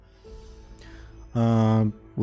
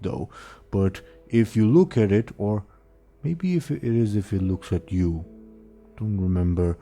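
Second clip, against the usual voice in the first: dopey ogre voice. Acted. GM voicing the ogre.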